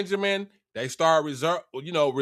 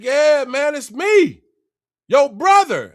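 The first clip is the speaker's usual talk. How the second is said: loud, raised-pitch insistence; emphatic reveal; 7 semitones higher, 7 dB louder, slower than usual. Retold or imitated. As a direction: announce yourself loudly to disbelieving brothers. Retold revealing himself to shocked brothers.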